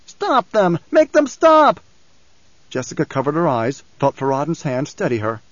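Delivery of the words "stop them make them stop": high, desperate cry building louder; frantic other voice. A narrator's reading. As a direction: beg in rising panic. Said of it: "And another voice".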